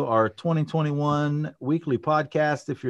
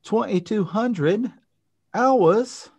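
high, stretched-out mock British; attempted British accent; 10 semitones higher, 3 dB louder, much slower than usual. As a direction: have a hammed-up go at a British accent. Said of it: "as they say in the U K".

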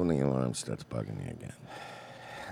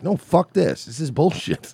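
higher and louder, fed-up outburst; a swimmer fed up with her team, loud and much higher; indignant protest; 10 semitones higher, 5 dB louder, slower than usual. Retold or imitated. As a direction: voice the swimmers' outrage with a raised voice.

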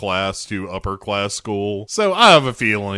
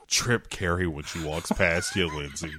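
soft, low, sneaky aside; conspiratorial teacher; 6 semitones lower, 8 dB quieter than usual. Acted. Teacher urging student to trip Carrie, low mock aside. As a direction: mutter it low like a secret instruction.

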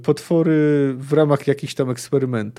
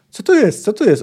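put-on perked-up, higher and louder old wizard; sudden lively curiosity, pitched up; suddenly animated; 7 semitones higher, 6 dB louder than usual. A narrator's reading. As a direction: burst into eager, high-pitched questioning. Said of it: "ożywił się nagle Ortolan".

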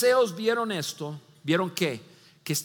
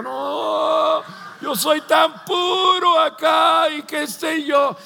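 loud, higher, slow self-righteous voice; mock pious shout; 6 semitones higher, 6 dB louder, much slower than usual. Mocking the self-righteous disciples, loud and slow. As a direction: ham up a holier-than-thou disciple.

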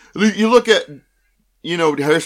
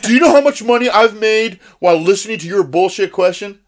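louder, higher sneering tycoon; arrogant rich boss; 6 semitones higher, 4 dB louder than usual. Retold or imitated. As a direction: brag down at the fan. Rich exec berating fan.

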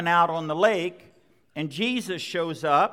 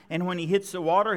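ordinary talk

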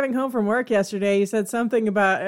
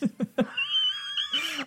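high laugh; 7 dB quieter than usual. Real, not acted. Laughing after the impression.

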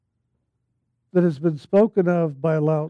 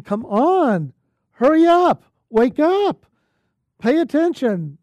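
raised, higher-pitched urging; mock yelling voice; 5 semitones higher than usual. Holy Spirit to the meditator, urgently yelling inside. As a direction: call out commands to rouse a dawdler. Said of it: "It's sometimes a yelling voice".

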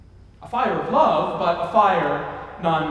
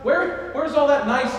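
incredulous; 6 semitones higher than usual. Retold or imitated. Voicing shocked listeners.

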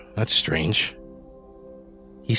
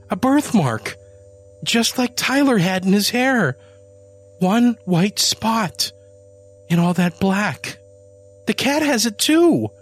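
much higher, louder, slowing frantic plea; desperate pleading; 13 semitones higher, 4 dB louder than usual. Acted. Janie pleading with Adam, high desperate voice. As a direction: plead to be believed. Janie insisting cat is son.

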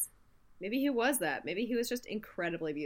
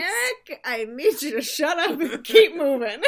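higher, louder bossy laugh; laughing order; 5 semitones higher, 9 dB louder than usual. Pretend. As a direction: laugh while ordering him on.

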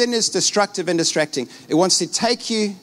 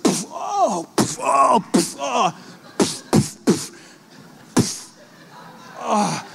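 mock explosion noises; 5 semitones higher, much slower than usual.

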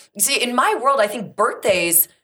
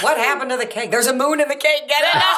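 mock alarm; 7 semitones higher than usual. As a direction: cry out in pretend alarm. Acting out the cake scene.

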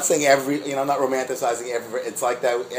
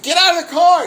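high, loud mock yell; high-pitched shouted command; retold scream; 6 dB louder than usual. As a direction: yell an order, imitating an angry guard. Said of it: "the guy screams at me".

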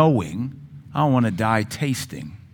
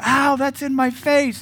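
put-on high, slow whiny child's yelp; child hit in the face by a Nerf dart; kid's complaint; 12 semitones higher, slower than usual. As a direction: squeal it like a kid hit by a dart. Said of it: "you hear one of the kids go".

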